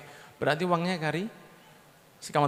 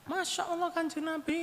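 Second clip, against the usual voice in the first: high, soft gasp of amazement; a woman startled to see the Prophet at her door; surprised woman; 11 semitones higher, 9 dB quieter than usual. Acted. Woman at the door sees the Prophet, high soft amazement.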